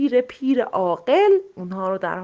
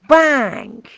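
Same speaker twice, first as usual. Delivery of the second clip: loud bang; 5 semitones higher, 9 dB louder, much slower than usual. A narrator's reading.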